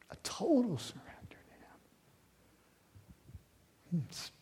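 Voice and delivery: soft reverent whisper. Real, not acted. Preacher to congregation, whispering total surrender. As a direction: breathe it, nearly silent.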